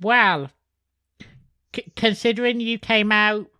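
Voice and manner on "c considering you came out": ordinary talk, nothing marked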